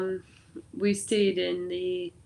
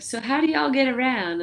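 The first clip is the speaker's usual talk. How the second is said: curious Southern voice; 6 semitones higher, 4 dB louder than usual. Retold or imitated. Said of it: "And like".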